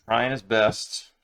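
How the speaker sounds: ordinary delivery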